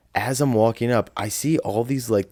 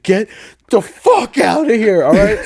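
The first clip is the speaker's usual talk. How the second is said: mean; 13 semitones higher, 7 dB louder than usual. Retold or imitated. Sister chasing onlookers away before the proposal, mean retold yell. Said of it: "never been so mean in my life".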